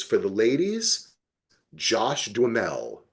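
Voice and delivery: usual speaking voice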